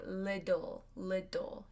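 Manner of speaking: little with the T as a quick D, liddle; flap T little. Shown as a demonstration. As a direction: say little as liddle, soft flapped T. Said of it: "we pronounce them as a D".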